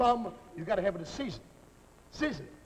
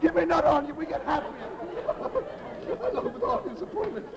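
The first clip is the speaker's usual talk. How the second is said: giddy; 7 semitones higher than usual. Acted. Chef gushing to the onions, high and giddy. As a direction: gush happily over the onions.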